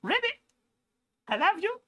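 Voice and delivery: squeaky high frog voice. Retold or imitated. Voicing the frog to viewers, squeaky high. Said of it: "He's like".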